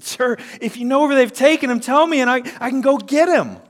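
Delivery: high, loud, desperate asking; a grieving woman begs the gardener; urgent plea. Acted. Mary pleading with the gardener.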